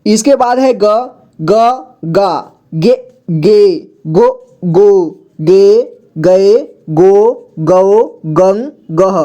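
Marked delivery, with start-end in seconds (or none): 1.4-9.2 slow ग series, each syllable apart; syllable drill. Shown as a demonstration. Teacher recites ग series so learners can read, slow drill. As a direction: recite each syllable, drawing out the long vowels. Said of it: "ताकि आपको सुनकर सभी को पढ़ना आ जाए".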